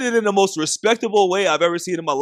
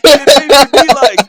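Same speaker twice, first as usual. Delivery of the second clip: raised, emphatic and loud; loud excited; 7 semitones higher, 12 dB louder than usual. Real, not acted. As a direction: push it out with energy.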